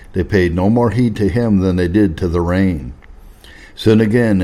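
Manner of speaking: usual speaking voice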